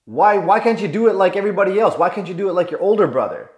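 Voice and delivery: acted scolding, high and heated; angry dad. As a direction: fire off the questions in frustration. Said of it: "what does dad do?".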